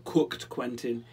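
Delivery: usual speaking voice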